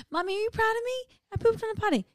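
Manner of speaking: put-on high little kid voice asking for praise; toddler voice. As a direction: squeak it out like a proud toddler. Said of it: "I'm like".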